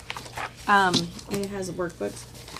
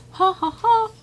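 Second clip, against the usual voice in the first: teary laugh; 13 semitones higher, 7 dB louder than usual. Real, not acted. Laughing after tearing up.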